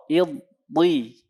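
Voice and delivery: "Iddi" with a held, heavy Arabic dad; demonstrated dod. Shown as a demonstration. Teacher drills dod with students, heavy held "Iddi". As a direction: press and hold the doubled consonant in "Iddi". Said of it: "Baik, perhatikan".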